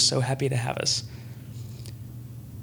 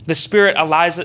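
ordinary delivery

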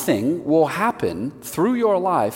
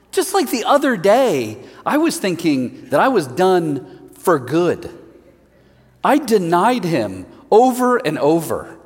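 a higher-pitched Peter, earnest and wondering; Peter retelling; 6 semitones higher, 3 dB louder than usual. Acted. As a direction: tell it as a shaken Peter. Preacher voices Peter's story.

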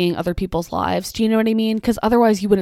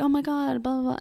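high but soft mock exclamation; mock emphatic; 6 semitones higher, 5 dB quieter than usual. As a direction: imitate an emphatic stranger, high and softened. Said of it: "like, emphatic and was like".

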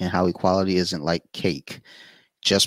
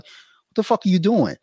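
baffled outburst; 11 semitones higher than usual. Retold or imitated. Retold confusion at friend's nudge.

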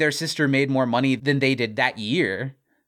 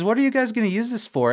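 lightly voiced questioning quote, pitched higher; Glenn; 8 semitones higher than usual. Glenn asks about the suit, lightly voiced worried question. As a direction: put a hint of higher-voiced doubt into the question. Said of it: "And he says".

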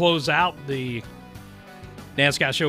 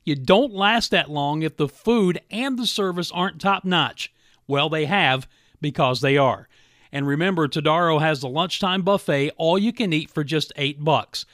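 upbeat, louder radio ad read; 4 semitones higher, 5 dB louder than usual. Acted. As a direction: sell it like a radio spot. Host reads live radio ad.

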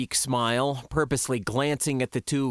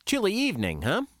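forced ease; 8 semitones higher, faster than usual. A narrator's reading. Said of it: "I spoke with forced ease".

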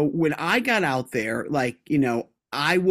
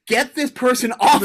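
higher, louder exasperated plea to get rid of a player; mock group plea; 7 semitones higher, 5 dB louder than usual. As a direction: ham up a fed-up teammate begging. Quoting teammates wanting someone gone.

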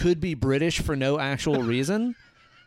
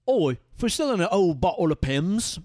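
hammed-up British ad pitch, a bit higher; British accent; 5 semitones higher than usual. Actor auditioning for a Pimm's commercial, hammed-up British accent. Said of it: "he was still in the accent".

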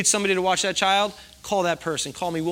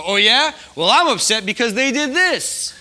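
louder, higher, slowed one-upper; mock one-upping; 7 semitones higher, 8 dB louder than usual. Imitating someone one-upping a friend, louder. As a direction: top their story, indignant. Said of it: "And you go".

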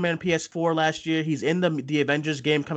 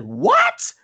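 high, loud yelp of disbelief; shocked what; 6 dB louder than usual. Retold or imitated. Retelling the moment the casting news broke, a loud high shocked cry. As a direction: shoot the word up in total shock. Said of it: "I said".

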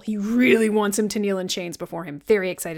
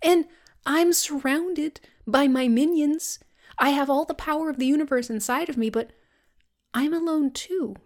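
pleading villain; 5 semitones higher than usual. Retold or imitated. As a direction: coax him like a lonely tyrant. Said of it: "he's like".

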